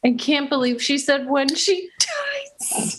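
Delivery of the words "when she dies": breathless horrified whisper; shocked whisper. Pretend. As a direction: gasp the words out in a whisper. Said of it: "shocked mock whisper of quote".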